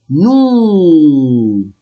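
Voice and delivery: long drawn-out "Nous" as "noo"; silent final S. Shown as a demonstration. Teacher models the pronoun, "Nous" long with no S. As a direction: stretch "Nous", leaving the S silent. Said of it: "S, I will not pronounce it".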